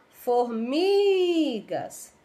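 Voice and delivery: forMIIIgas, MI held very long; stretched syllable. Shown as a demonstration. Teacher showing pupils which syllable stretched, formigas with long MI. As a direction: call formigas, drawing MI right out. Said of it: "qual foi a sílaba que se esticou".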